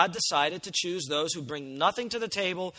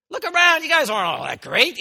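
much higher, louder scolding call; Paul's rebuke; 10 semitones higher, 10 dB louder than usual. Retold or imitated. Paul rebuking the proud Corinthians, loud and high. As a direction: shout it down at the boastful church. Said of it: "he's saying".

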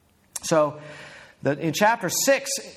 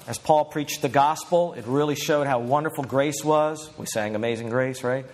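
usual speaking voice

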